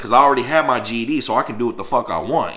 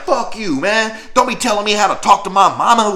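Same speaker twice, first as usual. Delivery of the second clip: high, louder offended retort; retold angry snap; 9 semitones higher, 4 dB louder than usual. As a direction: put him in his place. Snapping at the room guy.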